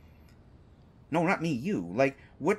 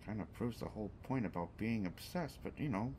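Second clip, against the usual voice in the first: much softer, lower snide under-the-breath remark; muttered aside; 4 semitones lower, 11 dB quieter than usual. Real, not acted. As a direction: mutter it half to yourself.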